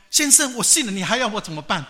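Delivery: high, loud, pleading outburst; loud exasperation. Acted. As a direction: raise your voice to get through to someone crying. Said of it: "那个人后来大声跟他讲说".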